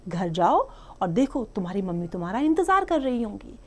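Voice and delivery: normal talking voice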